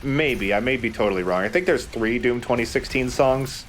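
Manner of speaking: ordinary delivery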